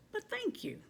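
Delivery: high, bright burst of thanks; a squeal of grateful thanks; excited thanks. Real, not acted. Thanking unknown sender.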